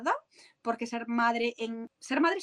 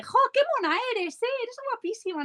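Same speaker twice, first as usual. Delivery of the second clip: put-on much higher gushing coo at a pretty girl; sugary tone switch; 12 semitones higher than usual. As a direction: switch to a syrupy voice praising her looks. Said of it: "cambia el tono". (cambio a voz muy aguda y melosa al halagar a la niña)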